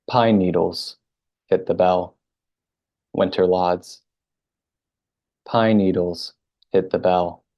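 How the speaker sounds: unhurried haiku recital, pausing between lines; poem reading. Acted. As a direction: savour every image, then pause. Reads her haiku, each twice.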